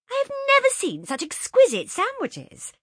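high, loud gushing praise; rapturous. A narrator's reading. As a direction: gush over the sandwiches. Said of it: "said Josie's rapturous voice".

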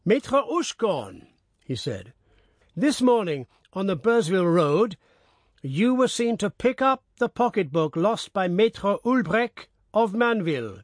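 lightly voiced pompous notary; pompous mayor. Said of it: "grave man of pompous speech".

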